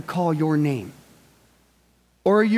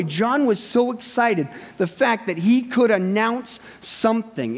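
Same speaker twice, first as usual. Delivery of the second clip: normal talking voice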